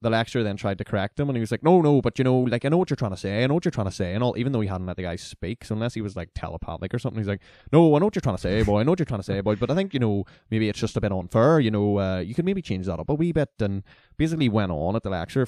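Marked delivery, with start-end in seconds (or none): 1.6-4.1 loud, higher mock Fermanagh country accent, rambling on; thick Fermanagh country accent. Country student protesting to the lecturer, in a mock thick Fermanagh accent. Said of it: "country accent I'd ever heard". 7.7-13.5 put-on thick Fermanagh country accent. Country student protesting to the lecturer, in a mock thick Fermanagh accent. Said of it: "country accent I'd ever heard".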